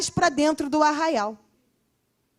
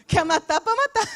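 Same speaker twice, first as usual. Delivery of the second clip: high laughing pitch of mock permission; 8 semitones higher than usual. Joking to the congregation, laughing. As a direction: toss it off through a laugh. Preacher joking he'd let God kill.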